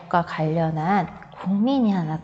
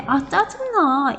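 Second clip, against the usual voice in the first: slow, higher exasperated groan of annoyance; irritated sigh; 6 semitones higher, slower than usual. Shown as a demonstration. Teacher demoing the wrong way to voice irritation, slow and exasperated. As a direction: show how not to say it, drawn out. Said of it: "이런 식으로 얘기하면 안 된다는 거예요".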